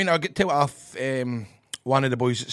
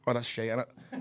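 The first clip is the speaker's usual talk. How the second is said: ordinary delivery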